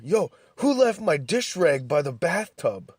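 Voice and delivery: mock-voice the roommate raging about his dish rag; imitating angry roommate. Roommate storming the apartment over a dish rag, angry demand. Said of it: "running around the apartment asking me".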